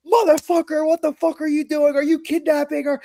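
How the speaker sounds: high, loud furious yell at the driver; angry yelling man. Retold or imitated. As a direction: scream accusations through the window. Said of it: "very heated younger man".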